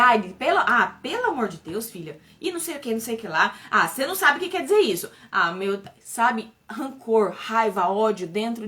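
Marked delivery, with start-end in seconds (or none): 0.0-2.2 rude brush-off. Retold or imitated. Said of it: "E eu respondia com tanta grosseria". 3.7-5.1 fast, louder mock-rude snap; rude retort. Mother imitating her own rude retort to daughter, fast and impatient. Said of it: "E eu respondia com tanta grosseria".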